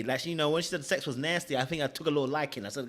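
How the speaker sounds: ordinary talk, nothing marked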